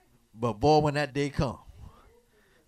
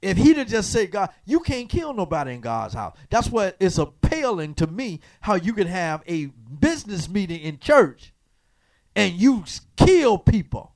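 louder and higher, rising preacher's call; preaching at full force; 5 semitones higher, 7 dB louder than usual. Acted. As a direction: drive it home to the congregation.

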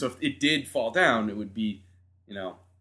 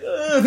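high, louder grunt as she hits the snow; a startled high ugh; groan from falling; 12 semitones higher, 6 dB louder than usual. Retold or imitated. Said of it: "I was like".

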